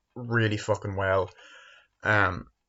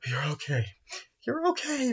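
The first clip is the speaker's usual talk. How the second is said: mock self-comforting; 14 semitones higher, 3 dB quieter than usual. Retelling Jamie comforting herself.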